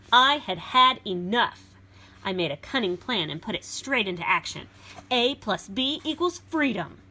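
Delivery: put-on lively read-aloud for children; storybook reading. A narrator's reading. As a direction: read it like a picture book to a class. Reads beast's version.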